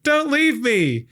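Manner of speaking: high, loud, drawn-out plea; hammed-up, high-pitched cry; mock desperate yell. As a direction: yell after a companion in mock despair. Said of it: "we hear the other figure yell".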